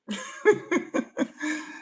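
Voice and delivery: a breathless, high, unvoiced laugh; airy laugh. Real, not acted. Laughing to viewers about being answered in Spanish. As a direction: let a silent wheeze of a laugh out. Laughs at his own story.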